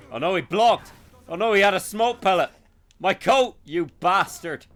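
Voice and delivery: loud high outraged yelling at the enemy; shocked yell. Real, not acted. Gamer to a thief who ruined his coat: loud outraged yell. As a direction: yell in real outrage at the game.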